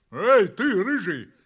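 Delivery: loud, bossy dog hailing a kitten; calling out. A narrator's reading. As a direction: call out loudly to someone across the yard. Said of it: "позвала собака". (громкий оклик)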